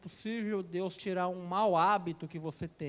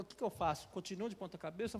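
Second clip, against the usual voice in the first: embarrassed quiet; 8 dB quieter, faster than usual. Retold or imitated. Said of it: "Ela constrangida".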